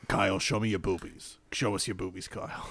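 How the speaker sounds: low, quiet, leering; low sleazy host voice. Pretend. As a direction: lean in and leer it. Mock shock-jock demand to Kyle.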